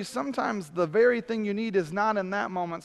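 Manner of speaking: ordinary talk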